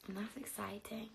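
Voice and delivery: soft whispered thrill. Real, not acted. Telling viewers about travel in a whisper. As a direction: whisper it like a secret.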